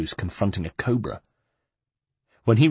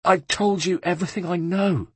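stubborn reply; 9 semitones higher than usual. A narrator's reading. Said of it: "stubbornly replied".

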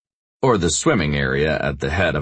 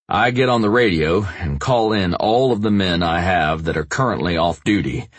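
ordinary talk

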